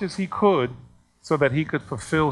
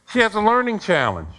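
retold angry protest; 7 semitones higher, 4 dB louder than usual. Acted complaint of angry parent.

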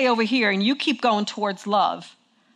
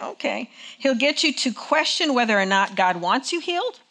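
ordinary delivery

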